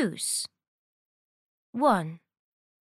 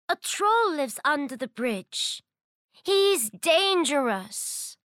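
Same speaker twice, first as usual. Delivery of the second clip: higher, anxious motherly warning; worried mother goat; 6 semitones higher than usual. Acted. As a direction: warn her child with worry.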